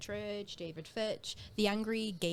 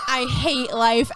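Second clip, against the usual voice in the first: mock despair; 11 dB louder, slower than usual. Voices the gunman's despair from show.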